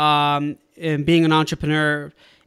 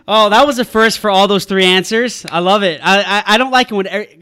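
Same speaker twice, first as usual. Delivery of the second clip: excited; 3 semitones higher, 9 dB louder than usual. Real, not acted. Host enthusing about the answers.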